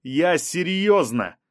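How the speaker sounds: mild drawn-out shout; shouted insistence. A narrator's reading. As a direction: call it out slowly to show you mean it. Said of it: "крикнул Рубен".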